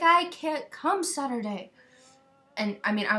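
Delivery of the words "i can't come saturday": slow, higher, louder protest; slow, exasperated and raised; exasperated reply. Retold or imitated. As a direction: drag it out in disbelief.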